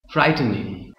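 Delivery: ordinary talk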